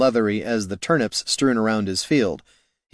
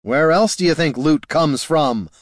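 raised voice, losing patience; hollered; 6 semitones higher, 4 dB louder than usual. Acted. Hero hollering at the farmer, out of patience. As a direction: hero snapping at the old man. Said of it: "hollered the hero".

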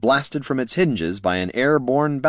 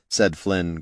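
ordinary delivery

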